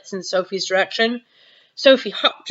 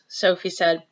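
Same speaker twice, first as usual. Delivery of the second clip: plain reading voice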